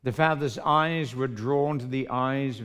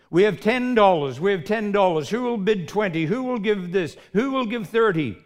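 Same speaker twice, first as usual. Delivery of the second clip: loud, high bid calling; auctioneer calling; 8 semitones higher, 8 dB louder than usual. Acted. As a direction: drive the bidding up.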